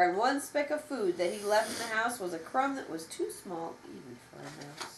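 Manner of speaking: ordinary delivery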